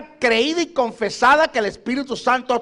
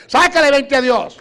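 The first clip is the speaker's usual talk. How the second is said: loud, rallying preacher's shout; forceful exhortation; 3 semitones higher, 6 dB louder than usual. Real, not acted. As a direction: fire off commands to the congregation. Preacher exhorting congregation at climax.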